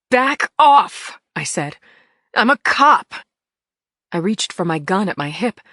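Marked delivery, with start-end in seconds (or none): 0.1-1.2 sharp loud back off; loud command. A narrator's reading. Cop fends off attackers. 2.3-3.1 sharp loud back off; loud command. A narrator's reading. Cop fends off attackers.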